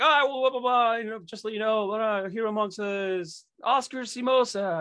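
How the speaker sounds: lightly voiced arena announcer, calling the name out. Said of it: "They're like".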